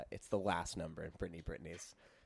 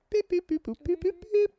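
phone beeps; 6 dB louder than usual. Pretend. Dialing phone with mouth.

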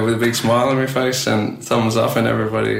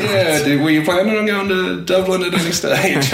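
voicing a local; 10 semitones higher, 3 dB louder than usual. Locals voiced in retold story.

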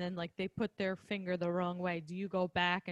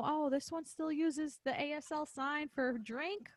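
a touch of delight, pitched higher; mildly excited reaction; 6 semitones higher, 5 dB louder than usual. Acted. Clinician seeing former students still signing, mildly delighted, higher voice. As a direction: build to a louder, pleased exclamation.

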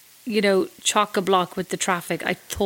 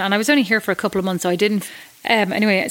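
ordinary talk

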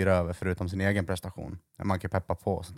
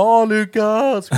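much higher, slow, drawn-out mock cheer; cheering friends; 13 semitones higher, 10 dB louder, much slower than usual. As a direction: greet Lukas like a party crowd, stretched out. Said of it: "Och de bara".

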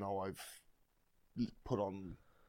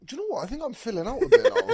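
laughing; 11 dB louder than usual. Pretend. Joking about his own weight.

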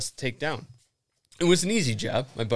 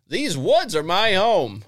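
old cartoon narrator; 7 semitones higher, 6 dB louder, slower than usual. Reading story in cartoon voice.

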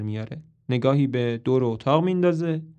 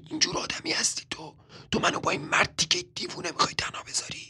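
higher, angry whisper; 5 semitones higher, 5 dB quieter, faster than usual. A narrator's reading. Marilyn whispering angrily at Toby so Carmichael won't hear. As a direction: hiss it secretly without voice.

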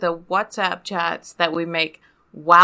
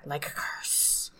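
whisper; 5 semitones lower, 16 dB quieter, slower than usual. Acted. Spooky aside about team name.

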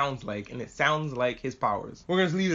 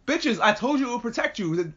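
high-pitched, indignant mock scolding; exasperated scolding; 8 semitones higher than usual. Scolding the kids left with the box, exasperated. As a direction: tell off silly kids in mock outrage, pitched up.

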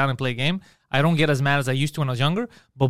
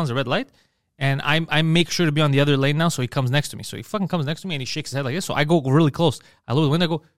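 normal talking voice

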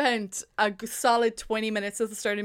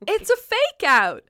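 loud, high dramatic twist reveal; spring the twist on them, drawn out; big slow plot-twist announcement; mock reveal; 10 semitones higher, 6 dB louder, slower than usual.

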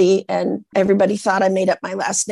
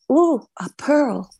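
higher, slow discovery; hammed-up delighted find; mock wonder; 4 semitones higher, slower than usual. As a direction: draw it out in playful awe. Acting out her oyster impression.

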